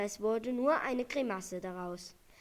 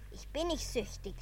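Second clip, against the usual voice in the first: indignant; 3 semitones higher than usual. A narrator's reading. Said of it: "rief er aufgebracht".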